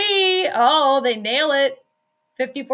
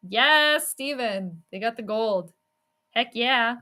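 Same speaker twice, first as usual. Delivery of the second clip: ordinary delivery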